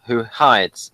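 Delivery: normal talking voice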